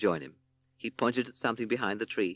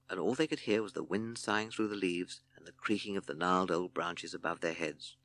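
ordinary delivery, nothing marked

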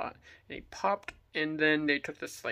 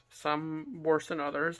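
usual speaking voice

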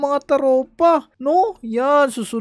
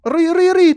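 hammed-up, higher ouch ouch; mock cries of pain; 6 semitones higher, 4 dB louder, much faster than usual. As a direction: yelp as if punched. Gets hit by the bully.

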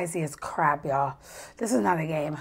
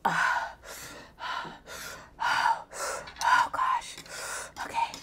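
whisper; 4 semitones lower, 12 dB quieter, much slower than usual. Real, not acted. Psyching up through the pain.

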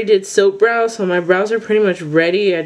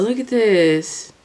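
ordinary talk, nothing marked

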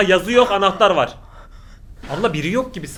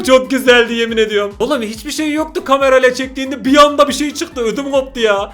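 shaken excitement; 6 semitones higher, 7 dB louder than usual. Real, not acted. After jump scare, shaken.

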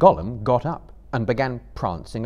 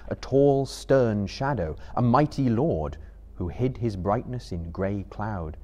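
plain reading voice

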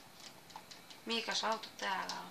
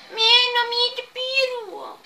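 loud, much higher, slow what the hell; shouting; 13 semitones higher, 15 dB louder, much slower than usual. Real, not acted. Gamer yelling in disbelief at the game. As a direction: yell it at the screen. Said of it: "Älä huuda mulle".

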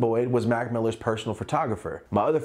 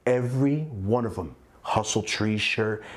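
ordinary talk

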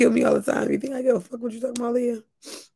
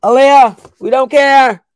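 loud, raised call to Aaliyah; loud dismissal; 5 semitones higher, 12 dB louder than usual. Acted. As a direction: yell it to brush her off. Brushing off Leah.

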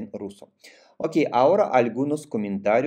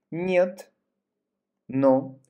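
ordinary talk, nothing marked

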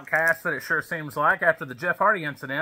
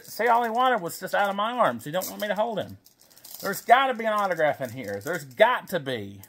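ordinary talk

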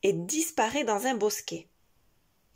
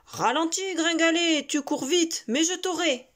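higher, loud wolf voice; howling wolf; 8 semitones higher, 9 dB louder than usual. Acted. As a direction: shout like a crazed wolf. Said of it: "le loup hurle comme un zinzin".

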